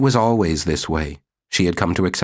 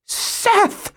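very high shrill call of "Seth"; shrill cry. Acted. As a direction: shriek her husband's name. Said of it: "in a shrill voice".

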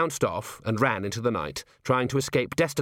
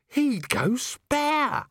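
high, very slow wail; awed dread; much slower than usual. Acted. Nobby stares into gloom picturing Vimes, wailing. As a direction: stretch each word in comic dread.

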